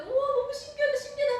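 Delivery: high, loud gasp of wonder; amazed wow. Acted. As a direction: marvel out loud like a child seeing magic. Acting Peter amazed on water.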